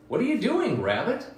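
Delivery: high mouse calling out. Acted. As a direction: call across the meadow as a little mouse. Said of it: "Mouse called".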